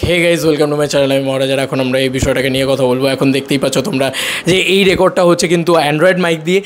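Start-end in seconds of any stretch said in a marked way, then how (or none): 0.1-4.1 deepen it for the intro; channel intro voice. Shown as a demonstration. Mic test channel intro.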